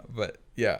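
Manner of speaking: ordinary delivery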